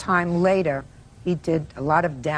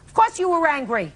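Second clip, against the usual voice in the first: raised, much higher-pitched rebuttal; emphatic contradiction; 8 semitones higher, 4 dB louder than usual. Real, not acted. Judge overruling the plaintiff's denial, emphatically incredulous. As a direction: flatly refuse to accept the denial, voice jumping up.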